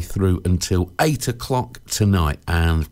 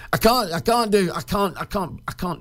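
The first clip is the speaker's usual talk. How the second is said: a higher, lightly put-on schoolboy pleading; whiny kid excuse; 9 semitones higher than usual. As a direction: play a kid begging off games. Acting a kid faking a sick note.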